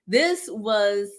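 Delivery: usual speaking voice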